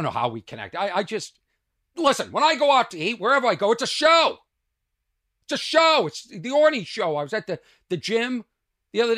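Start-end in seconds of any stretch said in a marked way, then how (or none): 2.0-7.2 much louder and higher, bragging; real excited bragging; excited boast. Real, not acted. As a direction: crow it like a showman. Boasting about his dining persona.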